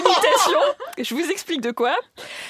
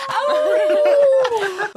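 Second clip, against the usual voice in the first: high, loud laughing wolf howl; howled wolf cry; 12 semitones higher, 6 dB louder than usual. Acted. Wolf howl running gag.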